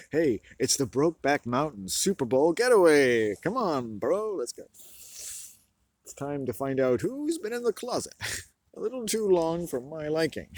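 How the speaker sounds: loud, high, mock bro hype voice; hammed-up party bro. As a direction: sell the getaway like a pumped-up party host. Comic put-on bro voice.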